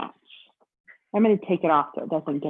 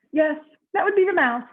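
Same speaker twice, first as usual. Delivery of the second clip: bellhop voice; 10 semitones higher, 6 dB louder than usual. Acted. Bellhop welcoming Mister Snore, high and cheery. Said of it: "said the bellhop".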